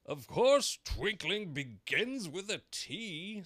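high, slow, loud sharp king. A narrator's reading. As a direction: snap it as a haughty king. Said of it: "said the king sharply".

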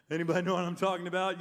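usual speaking voice

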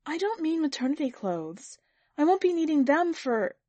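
high, hurt protest; wounded wife speaking up; stung. A narrator's reading. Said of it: "She was stung".

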